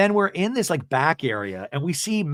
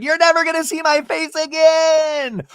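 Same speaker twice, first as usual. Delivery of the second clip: hammed-up exit line; mock dramatic exit; 14 semitones higher, 5 dB louder than usual. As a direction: storm off with a big declaration. [name] running off dramatically.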